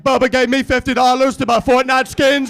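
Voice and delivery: loud imitation of a ten-year-old nephew; mock kid voice, projected; Forrest Gump. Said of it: "He sounds like Forrest Gump".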